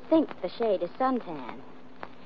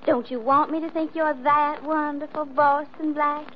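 mocking Southern belle, pitched up; mock Southern accent; 6 semitones higher than usual. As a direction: tease him with a syrupy put-on Southern accent. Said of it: "do you have to like me with an accent".

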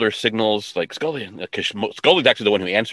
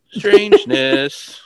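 drawn-out; 11 semitones higher, 5 dB louder, much slower than usual. Real, not acted. Drawn out comment.

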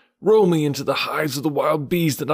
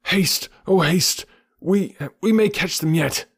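panted; 9 semitones higher than usual. A narrator's reading. Baloo pants as he trots after the monkeys. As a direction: gasp it out mid-run. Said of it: "Baloo panted".

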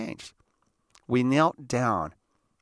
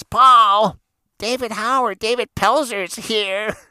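high, loud call through the house; mother calling out; 11 semitones higher, 7 dB louder, slower than usual. Retold or imitated. Mother calling Paul as his friends arrive, high-pitched holler. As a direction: holler for your kid, stretched at the end. Mom calls son to the door.

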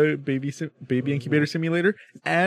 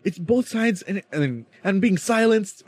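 high, stammering, whiny mock student; flustered student impression; 7 semitones higher than usual. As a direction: play the rattled classmate groping for words, pitched high. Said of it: "he's like".

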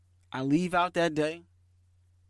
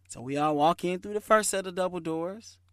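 normal talking voice

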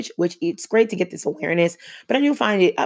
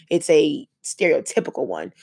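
normal talking voice

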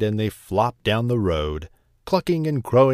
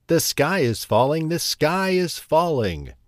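crying out; 5 semitones higher than usual. A narrator's reading. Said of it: "cried Chicken Little".

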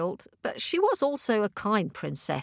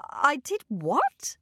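astonished; 6 semitones higher, slower than usual. Acted. Princess astonished.

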